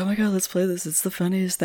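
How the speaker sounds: lightly hammed-up enthusiasm, kept quiet; mock eagerness. Player mimicking party guests who love the game, mock eagerness.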